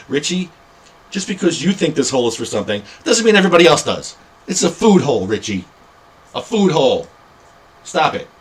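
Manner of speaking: high, loud telling-off; indignant scolding. Pretend. Telling Richie it's a food hole, indignant scolding. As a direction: scold him like a naughty pest.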